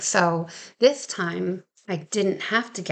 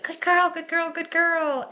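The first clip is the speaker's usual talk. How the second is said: high sing-song dog praise; praising the dog; 9 semitones higher than usual. Retold or imitated. Trainer praising Lucy on the dock, high happy praise. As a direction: gush over the dog in a much higher voice.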